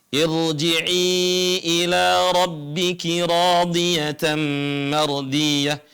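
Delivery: slow, chanted Quran verse; Quranic recitation. Shown as a demonstration. Teacher recites a Quran verse as tajwid example, slow chant. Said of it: "Contohnya ini".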